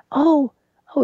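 put-on very high, slow, wavering old-lady voice; high granny voice in awe and mild fright; old grandmother voice. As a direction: squeak it out like an amazed granny afraid to fall. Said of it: "she's saying".

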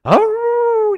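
loud, high imitated howl; dog howl. Storyteller imitating the dog that keeps on, howling. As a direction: howl long like a hound. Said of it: "he just keeps".

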